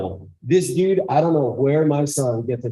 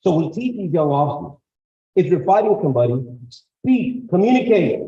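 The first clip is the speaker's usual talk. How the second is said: normal talking voice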